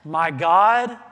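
loud, anguished call to God; anguished cry. Retold or imitated. Jesus on the cross crying out to God, anguished loud cry. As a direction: cry it out in agony. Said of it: "Jesus cries out".

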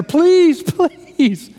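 sudden high loud plea, then a strained please; Haman begging. Retold or imitated. Haman begging the queen for his life, desperate high plea. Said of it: "is begging her".

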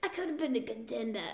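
soft mumbly movie-star impression; Marlon Brando. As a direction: do the famous screen actor, softly. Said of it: "That was a really good Marlon Brando".

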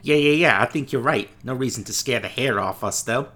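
put-on loud Brooklyn tough-guy accent; Brooklyn wise guy accent. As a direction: Brooklyn wiseguy, fast and pushy. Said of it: "That's a pretty good accent".

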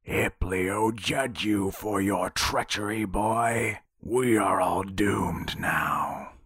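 gravelly crone pronouncing doom, slowing at the end; gravelly booming old woman. A narrator's reading. Old woman cursing the boy from the bow, gravelly and booming. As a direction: boom it out like an old prophetess, gravelly. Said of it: "The gravelly voice of the old woman came booming".

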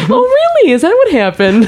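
very high, innocently curious questioning; imitating Marge reacting. Marge reacting to a Rashomon story, innocently curious. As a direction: swoop up high in naive interest. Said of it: "innocent, curious way".

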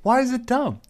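high squeaky outrage; mock indignant. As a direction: defend it in a squeal.

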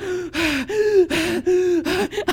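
a big gasp for air; sharp breathless gasp; winded gasp. Retold or imitated. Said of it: "you're like".

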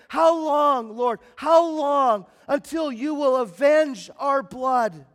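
loud, slow pleading cry of the martyrs. Acted. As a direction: cry out to God for justice, drawn out. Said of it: "they're crying out to the throne".

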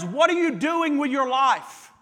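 usual speaking voice

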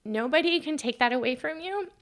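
emotional quote. Retold or imitated. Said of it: "she said".

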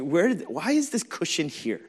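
comically put-out questioning; retold frustration. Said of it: "So I'm, like, so frustrated".

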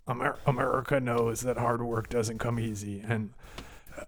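soft, low Yellowstone cowboy read; ad slogan voice. Acted. Podcaster reading the real logo text aloud, soft cowboy ad voice. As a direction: read it hushed and low, like a solemn cowboy commercial. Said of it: "say it in the voice that I think they want you to read it like".

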